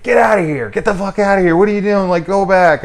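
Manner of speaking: high, loud mock yell at the kid; imitating a shout. Man yelling at the kid to leave, loud imitated yell. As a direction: bark him away, hammed up. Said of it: "he's like".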